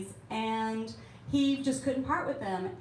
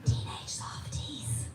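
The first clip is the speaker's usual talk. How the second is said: hushed whisper, slow; 2 semitones higher, slower than usual. Real, not acted. To the audience about teen books, in a hushed whisper. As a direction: lean in and whisper it like a secret.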